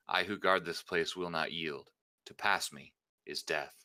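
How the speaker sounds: usual speaking voice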